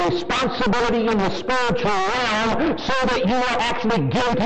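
high, ringing pulpit preaching; fiery revival preaching; full-force preaching. Acted. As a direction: hammer the point from the pulpit.

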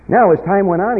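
loud pulpit voice driving the story; full-force preaching. Acted. Preacher picking the story back up for his flock, full force. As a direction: lift it back up to preaching pitch.

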